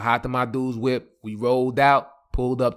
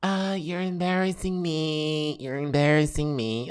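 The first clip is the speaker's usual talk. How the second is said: put-on embarrassed girlfriend; 3 semitones higher, 5 dB quieter than usual. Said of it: "she was like".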